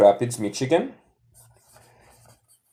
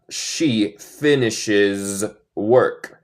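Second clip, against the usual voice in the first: slow model sentence; much slower than usual. Shown as a demonstration. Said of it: "teacher repeats model sentence".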